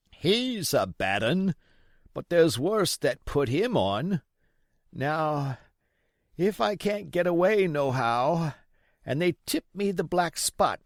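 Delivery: put-on old pirate begging Jim for rum; old sea captain. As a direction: play the sick old buccaneer wheedling the boy.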